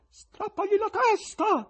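high, slow, stern call from the gallery. A narrator's reading. Gallery voice demanding decapitation, stern slow call. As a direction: demand it harshly. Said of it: "disse una voce severa".

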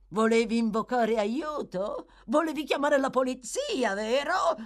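angry taunting interrogation; snarled. A narrator's reading. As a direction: snarl the questions, building to the last. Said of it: "ringhiò l'ebreo".

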